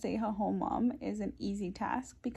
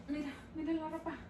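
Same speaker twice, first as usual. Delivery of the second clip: high hushed cooing; 7 semitones higher, 8 dB quieter, faster than usual. Real, not acted. Talking to baby about laundry.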